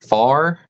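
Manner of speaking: far said for fire, one syllable; Appalachian pronunciation. Shown as a demonstration. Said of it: "for the hot stuff that burns".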